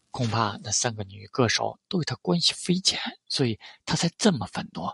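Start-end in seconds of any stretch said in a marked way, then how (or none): none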